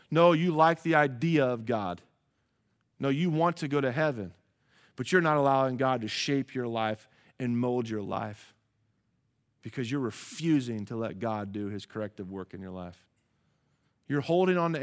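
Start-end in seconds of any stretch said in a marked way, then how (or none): none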